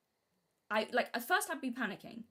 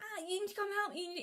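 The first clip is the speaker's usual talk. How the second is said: put-on high, pleading child's wail; whiny crying child; 11 semitones higher than usual. Stuck child in a tree begging parents, high tearful plea. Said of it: "even if I was crying".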